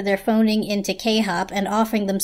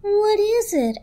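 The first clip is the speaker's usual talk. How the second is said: blanket character voice; 11 semitones higher, 6 dB louder, slower than usual. Acted. Blanket character asks a question.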